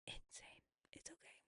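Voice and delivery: sheepish whispered confession; whispered aside. Real, not acted. Admitting to viewers she went crazy, whispered. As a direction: drop to a whisper, letting them in on it.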